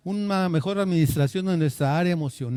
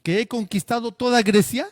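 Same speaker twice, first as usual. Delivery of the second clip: loud, higher, indignant demand; angry conqueror; 6 semitones higher, 5 dB louder than usual. Acted. Alexander in a rage at the philosopher, loud and indignant. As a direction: boom it out like an offended emperor. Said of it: "montó en cólera y le dice".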